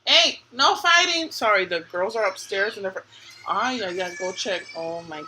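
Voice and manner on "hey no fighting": calling out. Real, not acted. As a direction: mom calling upstairs to break up a fight. Calls out to fighting kids upstairs.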